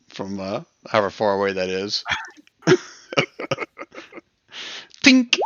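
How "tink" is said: tink sound effect. Acted. Mock arrow hit sound.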